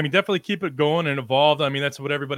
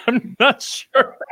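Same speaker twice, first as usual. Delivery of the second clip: slow and high, laughing it out; 9 semitones higher, 7 dB louder, much slower than usual. Real, not acted. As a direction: giggle through the words, unsure. Laughs at drinking challenge memory.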